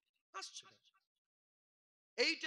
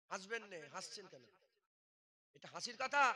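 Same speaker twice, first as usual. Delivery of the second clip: ordinary delivery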